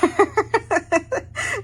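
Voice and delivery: high, loud squealing laugh. Real, not acted. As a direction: squeal with fangirl glee. Excited fangirling.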